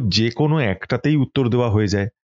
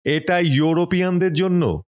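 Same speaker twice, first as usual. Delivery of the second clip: raised, called-out warning to the narrator; a hint of a hailing Anglo-Indian; calling out firinghi; 7 semitones higher than usual. A narrator's reading. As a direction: call it out like a railway Anglo-Indian. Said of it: "হেঁকে বলল".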